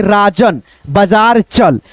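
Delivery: slow, low reading of राजन बाजार चल; slow dictation. Shown as a demonstration. Teacher repeating राजन बाजार चल, slowly. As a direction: say it slowly before writing. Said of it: "तो यहाँ पे ऐसे लिखेंगे".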